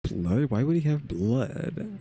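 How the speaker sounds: high, slow, disbelieving question; can't believe what he just heard; puzzled rise on the word he; surprised. Real, not acted. Host reacting to the twist.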